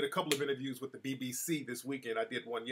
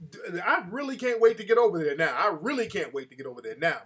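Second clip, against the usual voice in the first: sarcastic anticipation; 6 dB louder than usual. Retold or imitated. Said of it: "I'm like".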